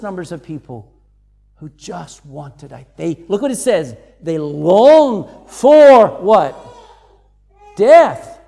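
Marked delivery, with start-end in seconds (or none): 4.2-8.4 preaching climax. Real, not acted.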